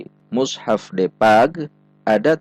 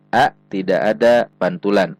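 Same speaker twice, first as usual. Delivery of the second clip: ordinary talk, nothing marked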